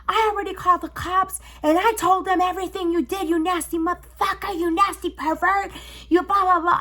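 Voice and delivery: high, heated telling-off; high-pitched angry rant at the pervert; angry yelling. Retold or imitated. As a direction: yell insults at him, fired up. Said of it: "I was going off".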